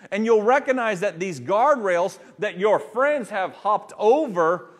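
loud, high, impassioned preaching; preaching at full force. Acted. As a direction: drive the message home to the congregation.

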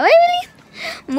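high, slow, startled double take; drawn out in sudden disbelief; surprised. Real, not acted. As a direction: caught off guard by the dog. Surprise at the dog.

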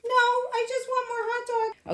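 high-pitched talking-dog voice; voiced pleading dog. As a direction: answer as the dog in a high little voice.

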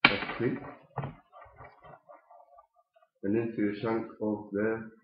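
talking around pipe stem; clenched teeth speech; pipe in mouth. Real, not acted. Seen 3: Pipe stem clenched in teeth throughout while he talks.

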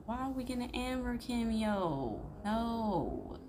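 high, drawn-out wail of objection; whining protest. Real, not acted. Streamer objecting to an Amber cameo, high whining protest. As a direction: protest loudly, stretching each no.

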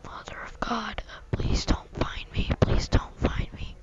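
hushed whisper, pleading; whispering while hiding. Real, not acted. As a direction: beg under your breath not to be found. Hiding from the seeker.

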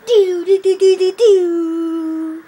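loud sung siren wail; MLG siren. Acted. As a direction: blare it like an air horn siren. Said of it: "It was an M L G siren".

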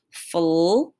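drawn-out sounds for a blend; slow and smooth. Shown as a demonstration. Teacher models a blend for the class, slow and smooth. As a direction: stretch the blend out for kids. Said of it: "say it slow and smooth".

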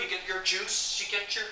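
ordinary delivery

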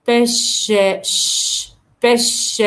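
بش ending in a long ش hiss; teacher isolates the sh sound. Shown as a demonstration. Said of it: "اوشيتا وبش".

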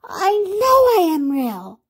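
high, drawn-out rabbit plea; plaintive storybook cry; calling out. A narrator's reading. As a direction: plead slowly, calling after them high. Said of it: "called the little rabbit".

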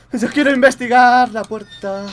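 high, loud, slow mock whine; mocking whiny character voice. Gamer mocks the character's stubborn wish, high mocking whine. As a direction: mimic the silly character stubbornly. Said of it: "mocking the character".

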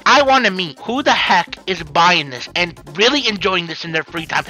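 ordinary delivery

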